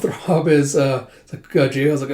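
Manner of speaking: ordinary delivery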